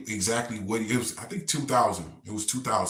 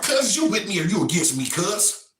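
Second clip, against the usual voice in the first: high, loud challenge; challenging demand; 10 semitones higher, 5 dB louder than usual. Retold or imitated. Inmate demanding narrator pick a side, loud challenge.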